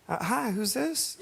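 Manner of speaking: high, hesitant phone voice. Retold or imitated.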